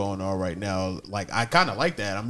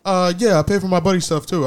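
loud, high imitation of a biker; imitated biker; 10 semitones higher, 9 dB louder than usual. Biker paying at the gas station, imitated loud and high. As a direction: pitch it up and project, as the biker. Quotes biker talking to cashier.

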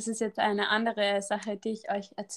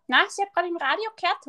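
higher, quick retort imitating a cashier; mock insistent retort; 8 semitones higher, faster than usual. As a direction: mimic her insisting, pitched up and fast. Retold cashier arguing back.

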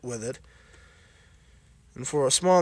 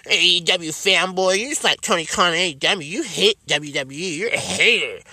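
higher, sneering hater voice; mocking fan voice; 3 semitones higher than usual. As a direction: parrot an online troll mockingly. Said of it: "as a lot of people".

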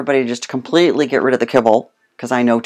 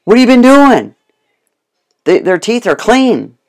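much higher and louder, amazed; shocked vet; 8 semitones higher, 8 dB louder than usual. Retold or imitated. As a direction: vet can't believe what he sees.

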